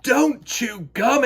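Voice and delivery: loud, slow scolding; stern teacher. A narrator's reading. Teacher telling David off for gum, slow and stern. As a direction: spell out each word like a strict teacher.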